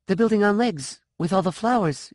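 put-on high, tumbling girl's voice; the memory spilling out; eager girl. A narrator's reading. Sean describing the building to the woman, high and eager. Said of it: "she blurted".

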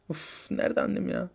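hushed worried whisper. Acted. Child whispering worriedly about his mother. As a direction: say it under your breath.

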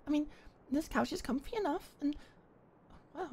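ordinary talk, nothing marked

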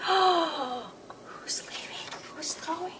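hushed worried whisper; anxious whisper. Pretend. Puppet worried about the moving van, whispering. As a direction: breathe the questions in worry.

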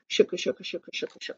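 unvoiced rhythmic shuffling chant; whispered shooka rhythm. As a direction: breathe the shooka sounds like footsteps. Sound of walking through leaves.

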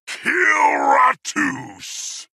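high, drawn-out announcer shout; roared kill call. Acted. Announcer hailing a kill streak, high drawn-out shout. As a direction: stretch it into a soaring battle cry.

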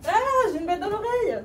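high panicked cry. Acted. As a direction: sound alarmed about the ear. Bug stuck in the ear.

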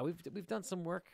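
ordinary talk, nothing marked

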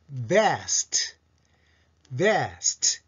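best as "vest" with buzzing v and long vowel; English-style vest. Shown as a demonstration. Teacher modelling the quiz answer, English vest. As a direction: draw out the vowel in best, then hiss st. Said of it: "です".

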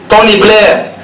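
ordinary talk

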